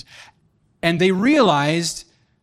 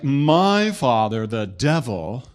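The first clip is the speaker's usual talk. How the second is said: very slow, drawn-out sinister warlock; hammed-up, ponderous dark sorcerer; 6 semitones lower, much slower than usual. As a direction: intone it like a robed warlock, dropping low. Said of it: "this dude was like".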